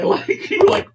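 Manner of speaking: high giggling words; breaking up with laughter mid-sentence; laughing. Real, not acted. Host laughing at the meme while telling it, giggling.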